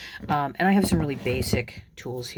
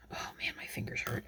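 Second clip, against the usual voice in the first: whispering; 12 dB quieter, faster than usual. Real, not acted. Sore fingers from wire.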